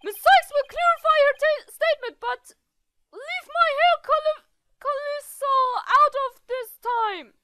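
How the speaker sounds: high, loud, put-on character voice; high and indignant; flustered objection. Flustered defence about hair.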